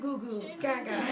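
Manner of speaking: put-on high, soft baby cooing; little high-pitched baby voice; baby babble. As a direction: imitate a happy baby. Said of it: "He's like".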